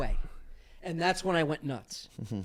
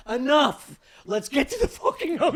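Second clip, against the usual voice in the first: loud, fed-up outburst; exasperated yell; 9 semitones higher, 6 dB louder than usual. Retold or imitated. Tripping friend yelling to get to the hotel, loud and fed up. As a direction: snap it out, done with the walk. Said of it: "And I'm like".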